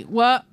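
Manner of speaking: "What" cut off with no audible T; silent T. Shown as a demonstration. Showing co-hosts Michigan speech, "What" with a silent T. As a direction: say "What" stopping just before the T. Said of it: "It's a silent T".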